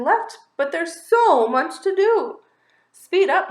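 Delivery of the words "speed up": high, loud mouse squeak; a mouse rushing the bunny, squeaking high and loud; squeaky mouse urging. Acted. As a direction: hurry him along like a tiny mouse. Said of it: "Marvin squeaks".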